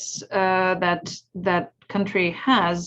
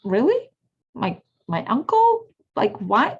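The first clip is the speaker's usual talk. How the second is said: incredulous, pitched higher; surprised; 3 semitones higher than usual. Retold or imitated. Niece shocked by her uncle's remark, incredulous and higher. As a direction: ask in disbelief, as if stung. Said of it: "And I'm like".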